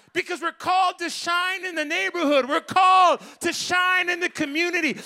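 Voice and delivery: loud, rising preacher's shout; preaching at full force. Acted. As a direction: build the crowd up to a roar.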